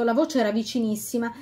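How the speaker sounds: usual speaking voice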